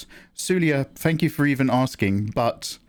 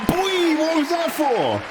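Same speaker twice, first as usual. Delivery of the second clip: squeaky, high disbelief; high surprised yelp; slower than usual. Real, not acted. Streamer asking Rogue Shogun why he donated, high surprised yelp. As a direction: yelp it in shock.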